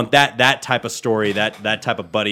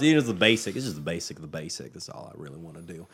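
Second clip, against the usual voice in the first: ordinary talk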